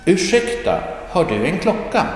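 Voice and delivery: Ursäkta, har du en klocka? pitched high and polite; polite asking voice. Acted. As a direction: ask high like a polite stranger. Dialogue question.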